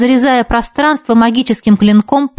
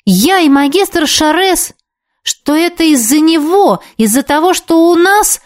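breathless indignation; 7 semitones higher than usual. Acted. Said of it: "задохнулась от возмущения".

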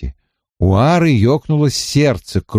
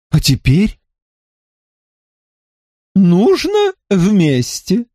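character voice; 8 semitones higher, slower than usual. A narrator's reading. Character urges pushing together.

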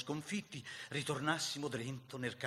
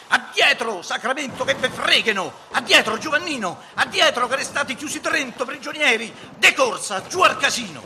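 high, loud, urgent yelling; shouted retreat order; 12 semitones higher, 16 dB louder than usual. A narrator's reading. Enrico calling Giovannino back to the house, urgent high shout. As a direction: bellow at the men to fall back. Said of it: "guarda la posizione un momentino e strilla".

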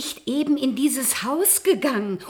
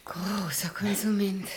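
whisper; 4 semitones lower, 7 dB quieter than usual. Acted. Strange repeated chant.

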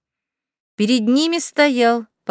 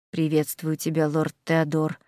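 low, rumbling demon voice; 7 semitones lower, 7 dB quieter than usual. Acted. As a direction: rumble it from inside a helmet. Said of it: "пророкотал из-под шлема призрачный демон".

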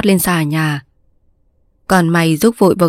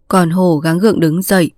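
plain reading voice

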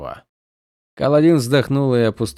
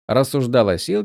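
usual speaking voice

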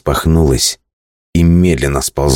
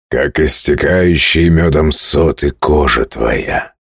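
slow, drawn-out singsong; slower than usual. Acted. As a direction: croon it like a lover. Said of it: "говорил нараспев Дрегар". (нараспев)

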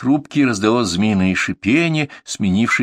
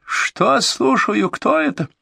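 high, slow outraged yell; shrieking; much slower than usual. A narrator's reading. Caller screaming.

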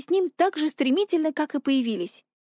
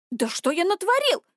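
higher-pitched nervous protest; edgy, raised-pitch question; nervous outcry; 6 semitones higher than usual. A narrator's reading. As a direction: cry out defensively, rattled. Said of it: "нервно вскрикнул парень".

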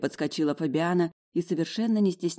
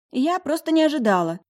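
high, loud flustered excuse; startled roommate; 11 semitones higher, 7 dB louder than usual. A narrator's reading. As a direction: blurt it out in a high voice. Startled roommate explains herself. (растерянно, высоким громким голосом)

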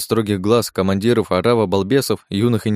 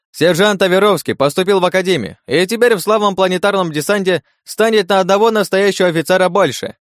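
booming announcement; 8 semitones higher, 3 dB louder than usual. Acted. Trai's crowd announcement.

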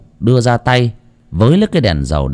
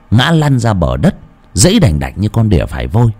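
ordinary delivery, nothing marked

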